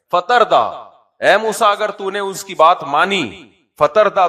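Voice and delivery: high, loud preaching; forceful warning. Acted. Preacher voices God warning Moses, in forceful high tones. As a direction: raise pitch and volume to warn.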